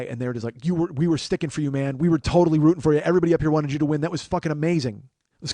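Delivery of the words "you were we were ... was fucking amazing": loud, gushing, pitched up; cheering stranger gushing loudly; excited fans. Retold or imitated. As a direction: rave at him like a thrilled fan.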